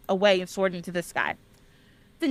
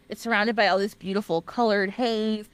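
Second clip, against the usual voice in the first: ordinary delivery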